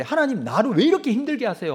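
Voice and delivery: imitating a loud, high plea of protest; mock anguished protest in prayer; protesting to God. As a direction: cry out the complaint to heaven. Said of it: "항변할 때가 있죠".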